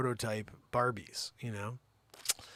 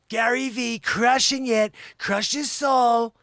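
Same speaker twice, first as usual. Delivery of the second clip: high, loud, drawn-out victory cry; triumphant shout; 8 semitones higher, 11 dB louder, slower than usual. Acted. Mocking Gary Vee winning the haggle, triumphant drawn-out shout. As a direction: bellow it slowly like a catchphrase.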